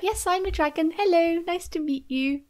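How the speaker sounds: soft, gentle greeting; softly voiced, careful hello; gentle dragon. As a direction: play a kindly dragon, softly. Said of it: "Temeraire is just very gentle".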